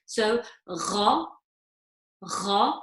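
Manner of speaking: gha with a gargle at the back of the throat and an a vowel; gargled gha. Shown as a demonstration. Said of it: "say it with a fatha on it".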